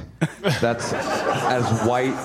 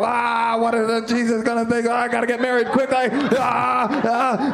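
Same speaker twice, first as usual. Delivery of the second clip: much higher, faster panicky gasps; 9 semitones higher than usual. Pretend. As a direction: gasp like a guilty churchgoer in a hurry.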